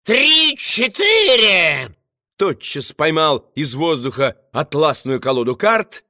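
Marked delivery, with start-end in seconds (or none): 0.0-1.9 high, drawn-out call; dashing shout. A narrator's reading. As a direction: call it out with a flourish. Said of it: "залихватски крикнул".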